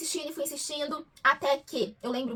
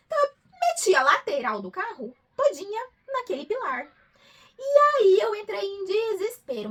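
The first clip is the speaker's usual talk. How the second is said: louder, higher crying recollection; tearful despair; 6 semitones higher, 7 dB louder, slower than usual. Retold or imitated. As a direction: retell the crash near tears. Crash, despair, crying heard. (relato choroso e alto)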